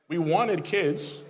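ordinary talk

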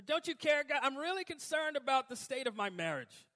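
high, loud pleading to God; half-laughing mock plea. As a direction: beg God with a touch of whining.

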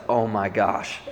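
low, hushed, stunned reaction; quiet dismay. Retold or imitated. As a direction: drop low and soft, as if the news just sank in. Reacts stunned to bad news.

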